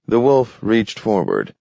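plain reading voice

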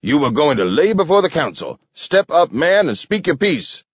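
high, angry, contained shout. A narrator's reading. King accusing Webster before the council, shouting. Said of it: "shouted King".

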